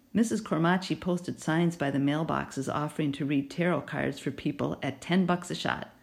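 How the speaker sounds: ordinary delivery, nothing marked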